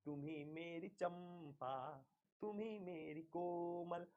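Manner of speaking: slow, soft, drawn-out lover's lines; tender lover's plea. Acted. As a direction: murmur each line slowly to the beloved. Teacher voices lover's tender plea. (धीमी, कोमल प्रेम भरी आवाज़)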